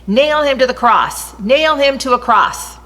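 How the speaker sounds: storyteller voicing a mob demanding the cross; crowd shouting. A narrator's reading. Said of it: "they kept shouting".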